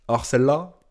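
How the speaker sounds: first beat of disbelief, held in; incredulous build-up. Real, not acted. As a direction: start the incredulous build-up, still restrained. Escalating incredulous reaction, first take. (incrédulité encore contenue)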